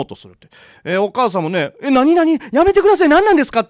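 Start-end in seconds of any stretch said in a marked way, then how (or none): 1.8-3.6 high, loud panicked pleading; panicked protest. Retold or imitated. Mother fights the old woman dragging her child, panicked high cries. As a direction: scream in disbelief.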